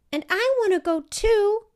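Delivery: put-on very high and loud child pleading; a small girl insisting she can come; high eager child voice; eager little girl. A narrator's reading. Child begging to join.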